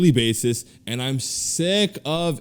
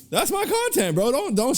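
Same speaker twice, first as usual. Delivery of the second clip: much higher, excited repeat of "That's my content"; 11 semitones higher than usual. Real, not acted. Podcaster hyping the clip he loved, excited and much higher. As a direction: jump way up on "That's my content" like he's thrilled.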